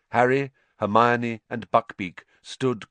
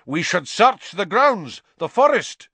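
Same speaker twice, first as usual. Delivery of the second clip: snarling; 10 semitones higher, 7 dB louder than usual. Acted. Executioner demanding a search, loud angry snarl. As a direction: snarl out the order, rising in fury. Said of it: "the executioner was snarling".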